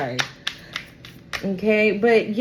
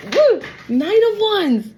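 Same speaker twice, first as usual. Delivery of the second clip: high, loud, slow woo of delight; thrilled woo over a tarot card; excited squeal; 11 semitones higher, 6 dB louder, slower than usual. Real, not acted. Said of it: "I can't".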